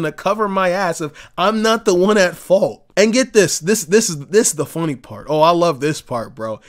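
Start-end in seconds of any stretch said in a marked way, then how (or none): none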